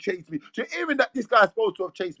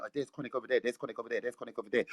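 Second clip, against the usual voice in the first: much softer, low murmured gossip; hushed crowd murmur; 11 semitones lower, 13 dB quieter, much faster than usual. Retold or imitated. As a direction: mimic the crowd murmuring under their breath. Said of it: "everyone was looking at the floor saying".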